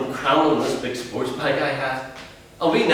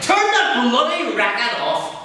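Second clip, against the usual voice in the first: higher, louder, slow and furious; father ordering son to kill the music, raging; angry bark; 10 semitones higher, 3 dB louder, slower than usual. Acted.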